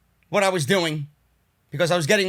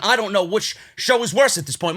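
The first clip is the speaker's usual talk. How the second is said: normal talking voice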